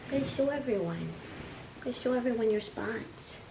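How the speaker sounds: high, soft coaxing pet voice. Real, not acted. Owner urging kitten to show its spots, soft coo. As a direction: coax the kitten gently.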